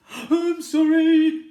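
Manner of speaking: high, loud, slow apology; scared dragon backing down; spluttering dragon. Acted. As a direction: stammer in fright. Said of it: "he spluttered".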